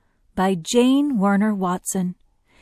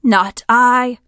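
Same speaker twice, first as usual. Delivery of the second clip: loud, higher-pitched vulture voice; swooping vulture; 5 semitones higher, 8 dB louder than usual. A narrator's reading. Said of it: "said the swooping vulture".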